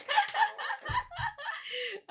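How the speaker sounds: long, high burst of laughter; squealing laughter; high-pitched laughing fit. Real, not acted.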